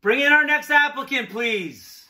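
loud call to the next room. Acted. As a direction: call Sharon in from afar. Calling out to assistant offstage.

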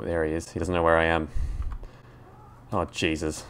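ordinary talk

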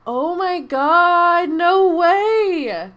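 loud slow oh my god; shocked. Real, not acted. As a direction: gasp in disbelief. Finding out Sim's pregnant.

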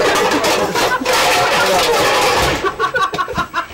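high loud burst of laughter; cartoon laugh. Real, not acted. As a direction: cackle loudly. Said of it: "Do I hear laughing?".

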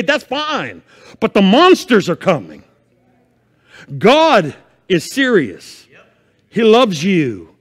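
loud, high, urgent sermon voice; preaching at full force. Acted. Pastor warning his church of the end times, preaching hard. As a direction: rouse the church with fire. Preacher warns monsters coming.

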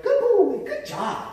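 put-on high praising "Good boy" falling to "good job"; praise marker. Shown as a demonstration. Trainer praising a dog for good behavior, high. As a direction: sing the praise up high then let it drop. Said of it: "So if he's doing something good".

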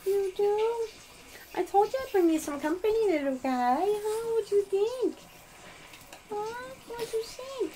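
high sing-song puppy talk; high cooing pet voice; baby talk to puppies. Acted. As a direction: coo at the dogs in a high voice.

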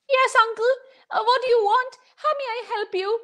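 put-on high, eager little boy; small boy voice. Storyteller voicing the little boy answering the traveler, high and polite. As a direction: pitch it up like a polite child.

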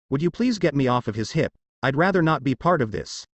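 ordinary delivery

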